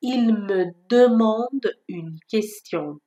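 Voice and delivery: "Il me demande une question" spoken very slowly; slow example. Shown as a demonstration. Teacher giving another example: very slow and clear. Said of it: "چند تا مثال دیگه با همین صدا".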